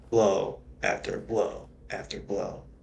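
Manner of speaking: usual speaking voice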